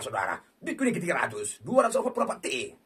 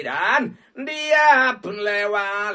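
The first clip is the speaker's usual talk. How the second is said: very slow, louder, mock-dramatic; drawn out; 3 semitones higher, 7 dB louder, much slower than usual. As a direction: stretch every word. Dramatic commentary on a miss.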